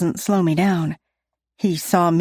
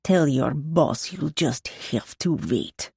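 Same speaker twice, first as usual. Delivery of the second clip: put-on angry Russian accent; 3 semitones lower, 4 dB quieter, slower than usual. A narrator's reading. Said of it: "He spits through clenched teeth".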